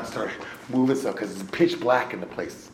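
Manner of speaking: ordinary talk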